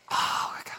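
hushed whisper in church; whispering in a pew so no one hears. Retold or imitated. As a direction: breathe it out under your breath. Stifling laughter in church.

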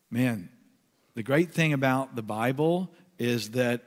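ordinary talk, nothing marked

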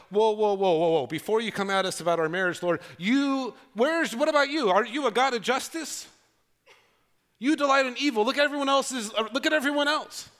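loud, high, fast mock protest; indignant Israelites. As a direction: whine back at God, deflecting. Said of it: "They go".

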